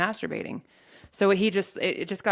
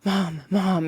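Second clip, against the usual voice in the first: retold frantic calling for mom, out of breath; a hint of breathless panic; breathless panicked call; 2 semitones higher, 3 dB louder than usual. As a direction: call for mom gasping and scared. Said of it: "and I can't even breathe".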